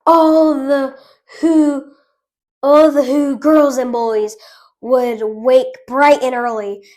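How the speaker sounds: ordinary delivery, nothing marked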